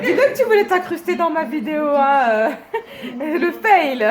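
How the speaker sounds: laughing loudly while teasing. Real, not acted. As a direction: talk through big laughs. Teasing friend, both laughing hard.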